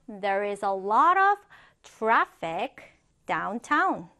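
slow, with pauses between phrases; slow chunked reading. Shown as a demonstration. Teacher modelling a traffic example for students, slow chunked reading. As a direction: break it into slow chunks for learners.

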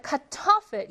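Kartoffel slowly, with TOF stressed; second-syllable stress. Shown as a demonstration. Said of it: "이 음절의 강세 있고요".

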